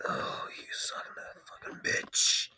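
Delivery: hushed, voiceless mock insult; whispered curse. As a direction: breathe the curse out, almost silent. Dog looks pissed, voicing it.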